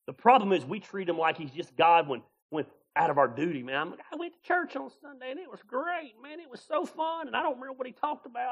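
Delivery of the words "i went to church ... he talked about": higher, softer airy churchgoer, fast at the end; mock churchgoer. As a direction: lampoon the shallow attendee. Mocking churchgoer voice.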